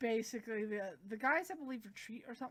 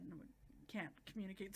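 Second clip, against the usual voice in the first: ordinary talk, nothing marked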